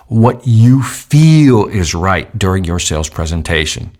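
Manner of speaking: slow, deliberate words; slow emphatic. Shown as a demonstration. Sales trainer slowing down to stress the point. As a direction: lean on each word slowly. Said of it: "Listen to what I just said".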